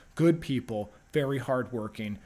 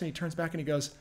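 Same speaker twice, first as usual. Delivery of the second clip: ordinary delivery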